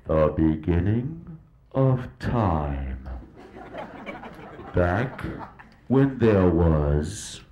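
low, very slow, portentous narration; documentary narrator. Acted. Narrator opening the story of life, grave and slow. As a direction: intone the dawn of time like a nature film.

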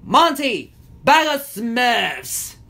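high, drawn-out villain call; stretched high-pitched summons. A narrator's reading. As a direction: call to a bird overhead. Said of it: "Gargamel called to the bird".

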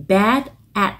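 "Bad at" joined, the d running into "at"; linked bad at. Shown as a demonstration. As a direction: say bad-at as one. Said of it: "nối âm là bad at".

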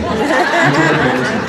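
high, slow, laughing "don't cry"; laughing mock consolation. Preacher acting the friend who laughs off a breakup, high and slow. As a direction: tease him gently through laughter.

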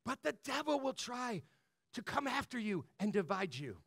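ordinary talk

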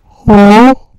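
loud, high hello into the mic; mic test shout. Shown as a demonstration. Streamer testing how the mic sounds, loud shout. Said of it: "I don't know how that sounds in the mic".

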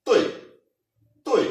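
तोय in Mandarin fourth tone, falling. Shown as a demonstration. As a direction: drop तोय hard like a command. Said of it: "इसकी जो tone है ये हमारे पास four tone है".

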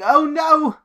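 high, loud hammed-up gasp of alarm; a caught suspect squeals in fright; mock suspect caught out; mock panic.

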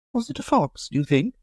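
high, light society lady asking; Amanda's voice. Acted. Amanda asks.

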